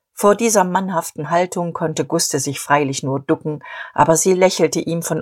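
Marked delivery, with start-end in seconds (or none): none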